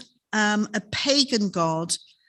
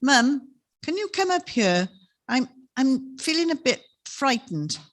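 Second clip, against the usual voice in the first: a lightly voiced small frightened boy, a bit higher; little boy's voice; 4 semitones higher than usual. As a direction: call down the stairs like a scared little boy. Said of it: "the little voice came down the well of the stairs".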